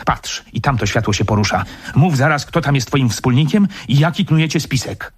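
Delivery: heated interrogation without shouting; angry calling out. Acted. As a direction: accuse and demand an answer at once. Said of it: "zawołał baronet".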